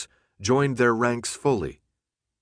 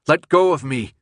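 louder, higher shouted demand; forceful shout; 5 semitones higher, 7 dB louder than usual. A narrator's reading. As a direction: shout it harder, insisting on release. Repeated demand at gunpoint.

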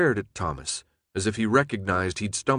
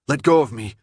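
loud, raised demand to be released; forceful demand; 4 semitones higher, 6 dB louder than usual. A narrator's reading. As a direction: order him off with force. Struggle at gunpoint.

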